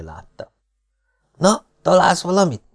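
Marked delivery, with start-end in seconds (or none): 1.4-2.6 high, eager inquiry; curious question. A narrator's reading. Coachman asks the Marquis about his search, curious. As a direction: ask with curiosity, pitched up. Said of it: "kérdezte kíváncsian a kockás".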